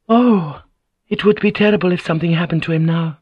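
high, quick, dismayed reaction; alarmed. Acted. Lady hearing of her lord's illness, alarmed.

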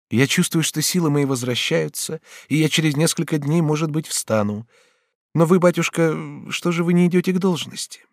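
high, gentle daughterly voice; reassuring Batilda. Acted. Recovering Batilda talks to her papa, reassuring. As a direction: reassure him lightly, then question.

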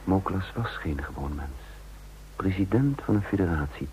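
measured, reflective storytelling voice; calm first-person narrator; story narration. A narrator's reading. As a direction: tell it looking back, unhurried. Narration of the confession afternoon.

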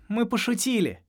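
bright, high playful teasing; cheerful. Acted. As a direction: chirp it like a joke is over. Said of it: "весело сказала Шари".

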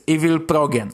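usual speaking voice

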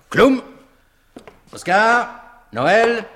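loud, high calls across the room; calling out. Acted. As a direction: summon each man by name. Calling out his men.

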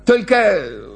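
high-pitched objecting storyteller; disapproving spectator. Acted. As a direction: dismiss the idea, then recount it high. (недовольный зритель, высоким голосом)

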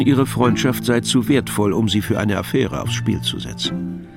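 ordinary talk

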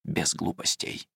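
soft, slow whisper. Acted. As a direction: breathe it as a warning. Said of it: "шепнув ему".

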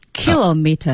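kilometer as "KIL-o-mee-tuh"; British kilometer, no r at the end; British pronunciation. Shown as a demonstration. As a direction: say kilometer with stress on the first syllable. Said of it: "英式是".

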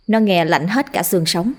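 usual speaking voice

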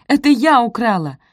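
high, loud cry; shouted confession. A narrator's reading. Posy confessing to the theft, shouting. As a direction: blurt the confession loudly. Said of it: "крикнула Пози".

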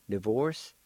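normal talking voice

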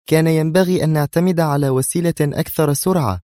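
high-pitched, mildly tense reproach. A narrator's reading. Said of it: "هزت منى رأسها في توتر قائلة". (بتوتر)

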